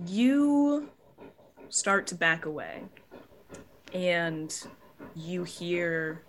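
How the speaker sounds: measured horror storytelling; game master's scene setting. Acted. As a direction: let each image land before the next.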